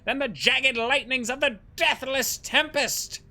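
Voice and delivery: high, loud, grandly earnest knight; wholesome knight voice. Acted. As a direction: proclaim it like a noble knight. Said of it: "somehow wholesome Spandam voice".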